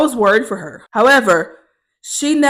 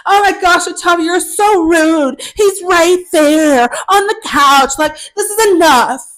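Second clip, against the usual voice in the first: high, loud, melodramatic roommate tirade; mock an offended roommate, stretching the words; hammed-up scandalized outburst; freaking out; 8 semitones higher, 5 dB louder, slower than usual. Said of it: "She freaks the fuck out".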